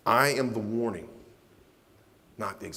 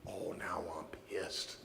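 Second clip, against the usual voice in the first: angry aside; 5 semitones lower, 14 dB quieter, slower than usual. Real, not acted.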